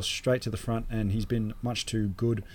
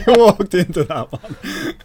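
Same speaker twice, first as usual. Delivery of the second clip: laughing; 12 semitones higher, 10 dB louder, slower than usual. Real, not acted. Teasing after a pun.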